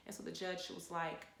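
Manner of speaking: normal talking voice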